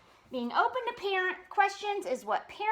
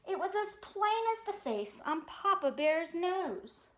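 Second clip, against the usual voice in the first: ordinary delivery, nothing marked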